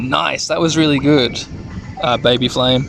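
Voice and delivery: normal talking voice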